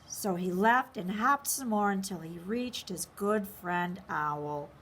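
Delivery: usual speaking voice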